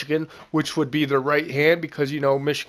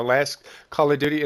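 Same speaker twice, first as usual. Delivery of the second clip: ordinary delivery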